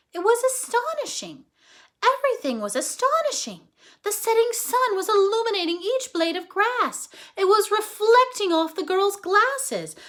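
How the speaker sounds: high and bright with wonder; awestruck. A narrator's reading. Narrator describing the squirrel's dazzled view, high awe. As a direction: let the wonder lift the voice.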